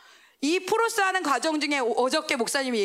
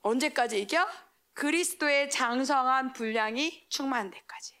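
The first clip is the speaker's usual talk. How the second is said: normal talking voice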